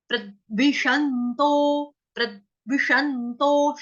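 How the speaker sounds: प्रद्विषन्तो slowly, stress on प्र; slow syllable demo. Shown as a demonstration. Teacher reciting for the class, slow. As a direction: draw out प्रद्विषन्तो evenly. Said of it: "आधे द का".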